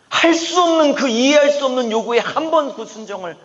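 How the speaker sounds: loud, high rising sermon climax; preaching at full force. Acted. Preacher exhorting the congregation on obedience, at full force. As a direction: build it up like a revival preacher.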